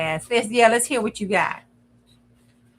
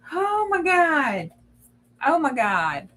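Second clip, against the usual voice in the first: amazed disbelief; 5 semitones higher, slower than usual. Real, not acted. Reacting to Alexa's jealousy.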